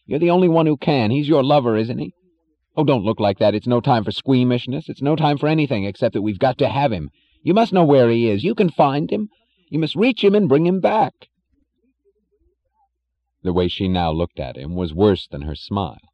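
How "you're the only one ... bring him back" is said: high, loud, frantic man pouring out bad news; panicked Jim. A narrator's reading. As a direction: babble it in rising, angry alarm. Jim demands Dagny fetch Rearden.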